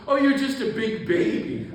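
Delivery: teasing insult, high and loud; Tutu. Retold or imitated. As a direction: jeer it loudly in a high, playful voice. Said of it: "he starts insulting me".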